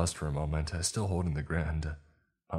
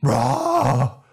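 very loud, high scream; scream of pain; 9 semitones higher, 13 dB louder than usual. A narrator's reading. Manager bitten on the arm, screaming. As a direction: scream out as teeth sink in. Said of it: "I screamed as she bit into my arm".

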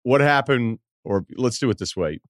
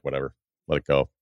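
lower, softer and fast, brushing it off; dismissive shrug; 7 semitones lower, 6 dB quieter, faster than usual. Retold or imitated. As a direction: wave it away like it's nothing, low and quick. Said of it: "they were like".

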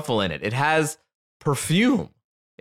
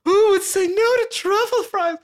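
ecstatic over truffle fries. Retold or imitated. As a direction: moan it like the best thing ever. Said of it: "But she was like".

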